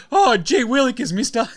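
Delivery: put-on high, gee-whiz kid voice; aw-shucks old-timey kid; wholesome 1950s kid. Teased about getting teary.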